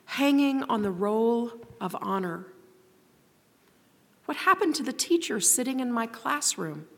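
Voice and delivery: a hint of a small child asking where everything went; softly voiced frightened child questioning an adult; young child's voice. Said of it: "I hear this poem in the voice of a young child".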